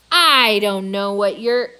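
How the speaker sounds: loud, slowed, menacing start; angry start. A narrator's reading. Angry boss glaring at the gorilla, loud and deliberate. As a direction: begin to rant at him, loud and slow. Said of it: "he starts to say".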